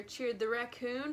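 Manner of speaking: usual speaking voice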